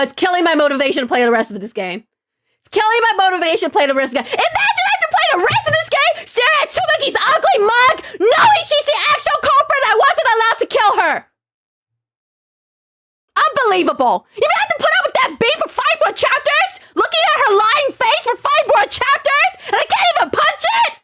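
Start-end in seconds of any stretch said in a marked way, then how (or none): 2.6-4.3 fast, higher-pitched exasperated rush; worked-up complaint. Real, not acted. Repeats complaint, more worked up. 4.3-11.2 squealing, very high outraged rant; upset rant. Real, not acted. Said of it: "I'm upset, guys". 13.4-20.9 incredulous, very high-pitched outrage; upset rant. Real, not acted. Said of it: "I'm upset, guys".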